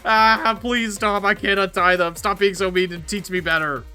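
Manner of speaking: high, loud whining plea; panicked pleading. Acted. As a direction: beg the bully to stop. Robber begs feral teacher.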